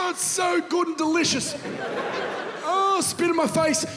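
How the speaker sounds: hammed-up pleasure voice about choking on Milo; mock ecstasy. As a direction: moan with exaggerated delight over thick Milo.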